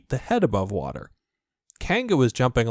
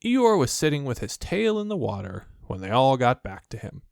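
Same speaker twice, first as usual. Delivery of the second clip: usual speaking voice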